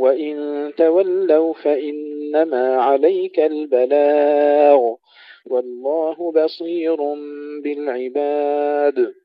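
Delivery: slow, melodic tajweed chant; Quran recitation. Acted. Recites Quran verses before lesson. (تلاوة مجودة بتأنٍ)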